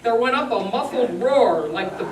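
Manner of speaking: ordinary delivery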